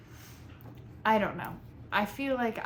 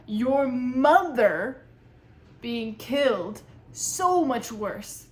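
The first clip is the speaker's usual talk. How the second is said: slow emphatic; 3 semitones higher, 7 dB louder, much slower than usual. Real, not acted. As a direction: drag it out for emphasis. Critique, mother death.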